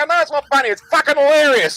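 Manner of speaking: loud, very high hysterical yell; gleeful shout back. Retold or imitated. Schoolboy defying his teacher, gleeful screaming retort. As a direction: shout it through mounting hilarity.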